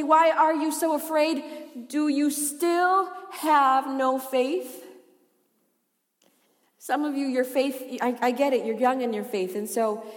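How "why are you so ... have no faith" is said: drawn-out, weighty question; slow emphatic reading. Acted. Preacher reading Jesus' question to disciples, slow emphatic reading. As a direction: stretch each word of the challenge.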